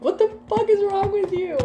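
hammed-up parent outrage; exasperated parent. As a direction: demand an answer from a naughty kid. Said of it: "That is the energy".

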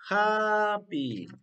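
"Happy" high, loud and stretched; long, emphatic "Happy"; model answer. Shown as a demonstration. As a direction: sing out "Happy" for learners. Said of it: "Así".

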